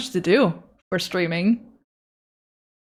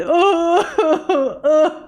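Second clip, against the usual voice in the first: teary laugh; 7 semitones higher than usual. Real, not acted. Crying over art.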